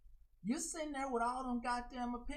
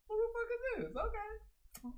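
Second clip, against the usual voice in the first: high, strained disbelief; mock incredulous squeal; 13 semitones higher than usual. Streamer repeating her incredulous thought in a high strained voice. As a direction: push the question up high.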